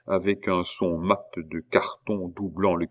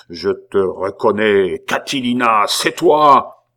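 prophetic transport; 2 semitones higher, 9 dB louder than usual. A narrator's reading. Abbé naming Pitou as Catilina, in prophetic fervour. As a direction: thunder the identification like a prophet. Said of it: "dans un transport de divination".

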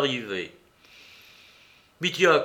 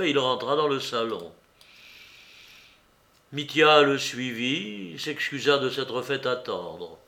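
usual speaking voice

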